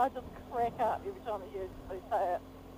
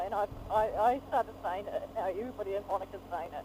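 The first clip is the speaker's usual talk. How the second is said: usual speaking voice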